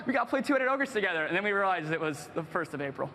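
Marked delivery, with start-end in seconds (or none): 0.0-1.2 higher, eager pitch to a best friend; retold excitement. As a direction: gush at your buddy, imitating your teen self. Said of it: "Mike, I'm like".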